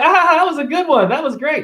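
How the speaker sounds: high, put-on voice of sarcastic fake praise; mock hater voice, way up high. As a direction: squeak out the fake compliment like a hater. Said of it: "They'll be like".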